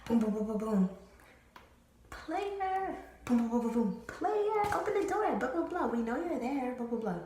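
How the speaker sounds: bang on the door and call him out; calling through the door. Retold or imitated. Best friend yelling through the door, hammed-up call.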